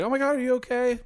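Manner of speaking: high, loud mock panic; Finn alarmed. Finn to Jake under the monster, in high mock panic. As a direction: shriek it like frantic Finn. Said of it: "And Finn's like".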